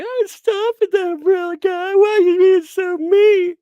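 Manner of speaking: high, loud mock whine; Josh Drew whining. Streamer voicing a whining rival begging him to stop, high, loud and mocking. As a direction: squeal it like a wounded crybaby.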